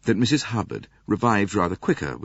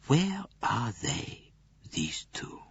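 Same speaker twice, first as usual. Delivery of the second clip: slow, softer, trailing into a whisper; hushed musing; 3 semitones higher, 8 dB quieter, slower than usual. A narrator's reading. Poirot asks where they are, hushed to a whisper. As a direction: wonder aloud, dropping to a whisper.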